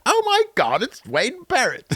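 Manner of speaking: very high, squeaky gasp; mock Nerd Cubed impression. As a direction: shriek the name in fake shock. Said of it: "I gotta stop".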